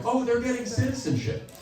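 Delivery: high, light, pleased remark; note it approvingly, pitched well up; easy high-voiced acceptance; mild approving reaction. Approving reaction, set against canceled.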